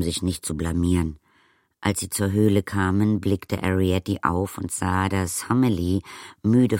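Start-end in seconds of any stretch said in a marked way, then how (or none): none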